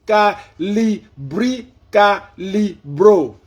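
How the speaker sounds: high, loud, syllable by syllable; loud slow query. Real, not acted. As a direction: spell it out loudly for a voice assistant. Slowly asking the voice assistant.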